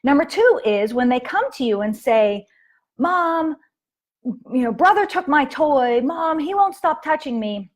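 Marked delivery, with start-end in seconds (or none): 3.0-7.7 put-on high, whiny kid tattling on a brother; mock child complaining to mom. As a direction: whine like a kid telling on a sibling.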